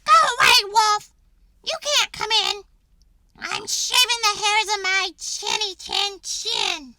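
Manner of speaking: high, loud pig yell; yelling back. Acted. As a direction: shout the wolf away from the door. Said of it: "He yelled back".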